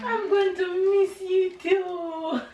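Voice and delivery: tearful. Real, not acted. Tearful goodbye to son.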